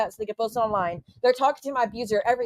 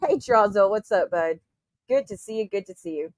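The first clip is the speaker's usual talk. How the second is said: ordinary delivery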